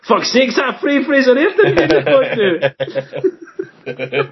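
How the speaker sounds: high laughing voice. Real, not acted. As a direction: squeal it out through laughter. Cracking up at the mock accent.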